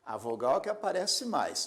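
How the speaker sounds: normal talking voice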